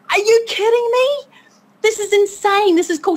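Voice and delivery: high-pitched incredulous excitement; high, gushing disbelief; retold amazement. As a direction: act out being blown away. Retold amazement at online hero.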